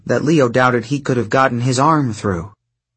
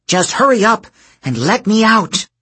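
high, pushy demand; trapped goddess demanding to be freed, impatient; 10 semitones higher than usual. Acted. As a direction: order him to hurry. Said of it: "Hera demanded".

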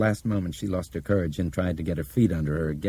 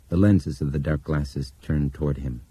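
ordinary delivery, nothing marked

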